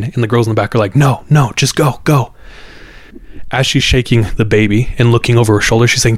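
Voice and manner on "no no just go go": higher-pitched retold panic; mildly panicked, insistent plea to leave; terrified urging. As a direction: imitate scared girls urging the driver on. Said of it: "they're all confused and terrified".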